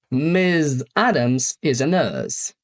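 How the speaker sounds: "Miss Adams is a nurse" drawn out, very slow. Shown as a demonstration. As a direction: read it at half speed for learners. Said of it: "Przykłady". (bardzo powoli, dla uczniów)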